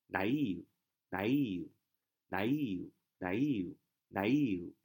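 naive said slowly, over and over, each one clear; a teacher drilling naive again and again; slow drilled naive. Shown as a demonstration. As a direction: repeat naive slowly for the class. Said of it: "重音在E那儿啊".

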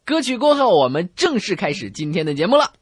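usual speaking voice

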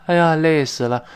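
tired groan. Shown as a demonstration. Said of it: "就叹一声气".

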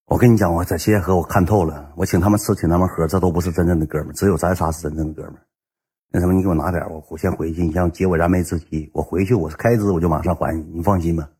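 low, soft buddy appeal; playing on friendship. Borrower working on old friends for cash, soft heartfelt appeal. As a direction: lean in quietly and sound confiding. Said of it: "就打感情牌".

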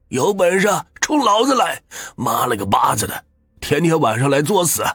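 put-on old man bellowing at ghosts to protect his wife; roaring, high-pitched tirade of rage; furious shout. A narrator's reading. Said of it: "对着空气大声地骂道".

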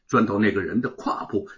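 ordinary delivery, nothing marked

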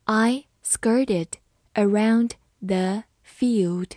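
slow, broken into separate words; slow reading. Shown as a demonstration. Teacher modelling the example for learners, slow reading. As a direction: read it slowly, pausing between words. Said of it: "好，慢读一遍".

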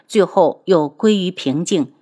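plain reading voice

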